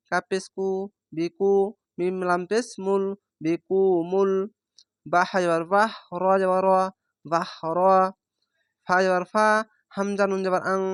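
ordinary talk

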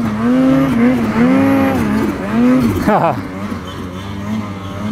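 a long amused laugh at the critics; real laugh. Real, not acted. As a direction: let out a genuine chuckle. Laughs after mocking critics.

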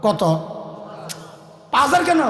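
usual speaking voice

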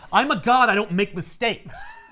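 acted haughty insistence, slowing at the end; indignant god. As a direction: proclaim it as an affronted deity. Acted god defending his choice.

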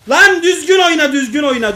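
loud high scolding shout; shouting at a teammate to play properly; angry yell. Real, not acted. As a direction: bark the order again and again.

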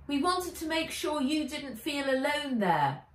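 a touch of kind teasing; Henry's voice. A narrator's reading. Henry explains the matching helmets, light voice. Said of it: "said Henry".

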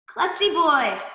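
mild cartoon kid anger; angrily. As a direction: scold a friend, hammed-up. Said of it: "said Schleppy Boy angrily".

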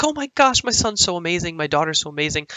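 high, gushing mom voice; mom's gushing praise. Retold or imitated. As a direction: gush like a proud mother. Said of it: "My mom's gonna come down and be like".

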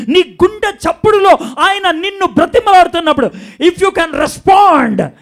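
preacher crying out to every heart; impassioned altar call. Acted. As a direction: plead with the crowd at the top of your voice. Altar call at full force.

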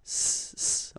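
"s" hissed hard and long, no voice; a strong voiceless s. Shown as a demonstration. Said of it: "the sound that you should produce is really strong".

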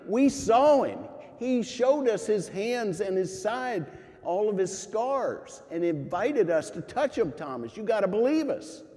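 high, loud, eager pleading; excited insistence. Retold or imitated. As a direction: urge him to believe, bursting with it. Disciples insisting to Thomas.

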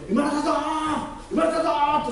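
high, ringing shout of joy; joyful angel cry. Retold or imitated. Angel shouting to Jesus about a new believer, high and joyful. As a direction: call it out to heaven in triumph.

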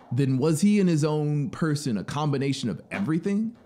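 the traveler can't believe it; traveler's astonished questions. A narrator's reading.

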